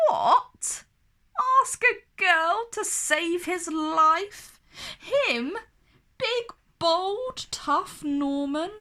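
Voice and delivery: high, loud indignant disbelief; scandalised prince; outraged. A narrator's reading. Norman outraged at idea.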